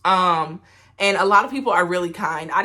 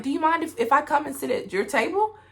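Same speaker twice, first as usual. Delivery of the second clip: imitated polite request in a raised pitch; Louise; 9 semitones higher than usual. Solo diner asking to share a table, high imitated voice. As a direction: lift the voice high to mimic her asking. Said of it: "she ended up asking".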